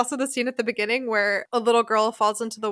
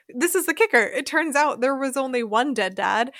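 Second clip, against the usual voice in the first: ordinary talk, nothing marked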